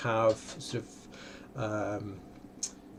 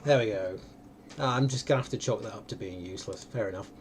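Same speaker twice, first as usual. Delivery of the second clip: ordinary talk, nothing marked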